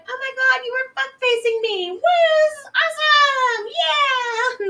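high, loud whooping delight; ecstatic woman. Retold or imitated. Host mimics the ex-fiance waking him, ecstatic high yelling. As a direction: squeal it out like a wild party girl.

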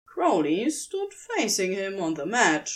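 plain reading voice

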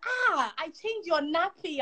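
high, drawn-out protesting uncle; indignant uncle. Retold or imitated. Uncle protests niece avoiding him, indignant. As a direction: imitate an affronted older relative, slow and high.

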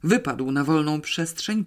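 usual speaking voice

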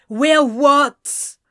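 loud angry yell; shouted. A narrator's reading. Anthony shouting at Ezra in the argument. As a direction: shout it at him. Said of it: "Anthony shouted".

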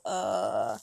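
long, low "uh"; drawn-out hesitation. Retold or imitated. Speaker showing how they get stuck mid-talk, hesitant. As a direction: stall on one low, stretched filler sound. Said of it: "sit there and go".

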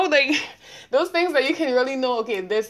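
normal talking voice

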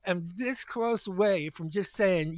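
usual speaking voice

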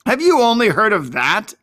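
a touch of scornful disbelief; sour. A narrator's reading. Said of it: "asked Ezra sourly".

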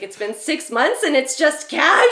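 hammed-up jilted girlfriend outrage; outraged girlfriend. A jilted girlfriend to her casual boyfriend, mock outrage.

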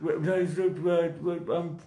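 badly faked southern English accent, restarting; attempted southern English accent. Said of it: "he's from down south".

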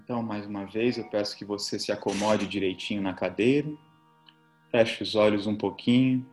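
soft, low relaxation voice; soothing spa whisper. Acted. Leads guided relaxation over music.

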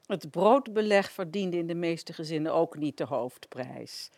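ordinary talk, nothing marked

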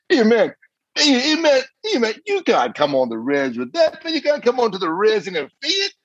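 high, teasing mock voice of laughing warriors; squeaky mocking taunt at the little car. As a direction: tease him like a giggling young guy. Warriors teasing him about Fiat.

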